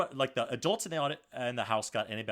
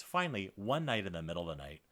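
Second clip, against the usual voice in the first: usual speaking voice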